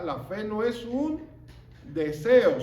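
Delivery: ordinary talk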